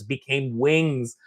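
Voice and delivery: ordinary delivery, nothing marked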